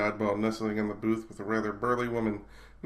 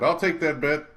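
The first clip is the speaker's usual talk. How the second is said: eager bet; 6 semitones higher, 8 dB louder than usual. Acted. Steele accepts a bet eagerly.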